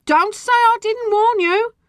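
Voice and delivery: high, loud, drawn-out warning; piercing parting shot; shriek. A narrator's reading. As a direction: shriek it after someone leaving. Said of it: "she shrieked after me".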